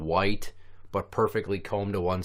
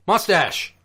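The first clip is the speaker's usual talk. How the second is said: mock curt shout, pitched up high; shouted word; 8 semitones higher, 3 dB louder than usual. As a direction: shout it back like a one-word order. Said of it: "just shouting out words".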